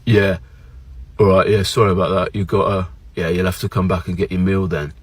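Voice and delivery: low, unbothered phone reply; indifferent UK staff. Retold or imitated. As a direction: imitate a bored British takeaway worker. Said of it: "call them up and they're like".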